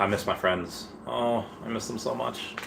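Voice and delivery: ordinary talk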